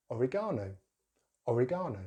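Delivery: oregano as o-ri-GAH-no; British pronunciation. Shown as a demonstration. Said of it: "say in a British way".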